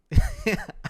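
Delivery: short, high burst of laughter; sudden amused giggle. Real, not acted. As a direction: crack up briefly, high and loud. Laughing about hating the character.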